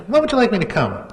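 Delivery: high sarcastic question; snide. Retold or imitated. Teasing the supposed prankster, high and sarcastic. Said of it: "I'm really being kinda snide".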